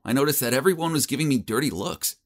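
plain reading voice

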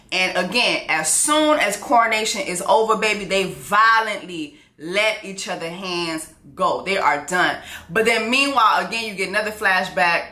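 ordinary talk

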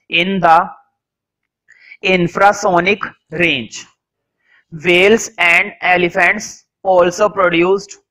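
very slow, word-by-word dictation. Real, not acted. Teacher dictating whale notes to students, slow dictation. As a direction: dictate in measured chunks with pauses.

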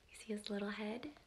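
fast soft whisper. Real, not acted. Asking the children to spot the hidden rabbit, whispered. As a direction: whisper so the fox won't hear.

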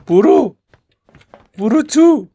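high, loud urging; urgent yell. Real, not acted. Player yells at his character to hide, urgent. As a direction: push him to get inside now.

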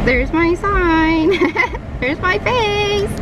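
high, slow giddy delight. Real, not acted. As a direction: gush over it. Seeing her own sign.

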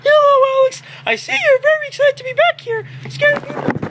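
very high squeaky puppet voice; squeaky toy voice. Pretend. Girl voices a toy for her friend, very high and squeaky. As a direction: give the toy a tiny squeaky voice. Voicing Mister Lady toy.

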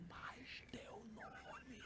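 slow, voiceless whisper; mouthed from afar. Retold or imitated. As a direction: mouth it across a room, barely any sound. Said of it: "eu só via a boca mexendo". (sussurro lento, quase só mexendo a boca)

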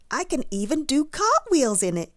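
high, loud, boastful whoop; excited whoop. A narrator's reading. Douglas showing off his new hat, whooping. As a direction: whoop it with glee. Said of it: "he whooped".

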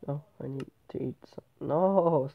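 ordinary talk, nothing marked

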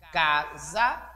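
"casa" in two separate syllables, ca, sa; teacher separating "casa" by syllable; split into syllables. Shown as a demonstration. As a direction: sound out "casa" with a gap before "sa". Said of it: "para ver qual é a sílaba inicial".

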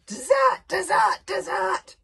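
high, loud far-off dragon shriek. A narrator's reading. Fireworm warning the boys of danger, loud high shriek. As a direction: screech it like a panicked little dragon.

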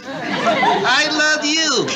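very high, slow ventriloquist voice. Acted. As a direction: talk through still lips like a dummy. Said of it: "say it without moving my lips".

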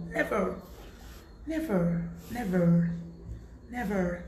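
never said with an English accent; English-accented never, drawn out with falling tune. Shown as a demonstration. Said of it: "Dites un peu never avec l'accent".

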